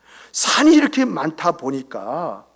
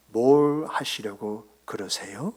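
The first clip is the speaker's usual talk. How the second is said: slow, lower, softer Chungcheong question; imitated Chungcheong person; 7 semitones lower, 6 dB quieter, slower than usual. Chungcheong churchgoer questioning the pastor's request, slow imitation. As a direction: draw it out like an unhurried Chungcheong person. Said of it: "충청도 사람은".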